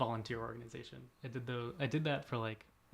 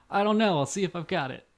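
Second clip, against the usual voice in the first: upbeat; 7 semitones higher, 9 dB louder than usual. Retold or imitated. Retold DJ answering caller.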